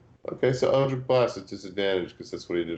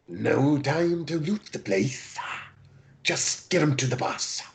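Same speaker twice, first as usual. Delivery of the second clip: scratchy, raised Kenku voice; 6 semitones higher than usual. Acted. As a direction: rasp it out, higher. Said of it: "in a scratchy voice".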